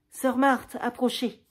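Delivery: appel bouffon, haut et fort; grosse voix comique. Acted. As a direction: faire le gros ton pour rire. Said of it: "Avec une grosse voix comique". (taquiner la religieuse d'une grosse voix comique)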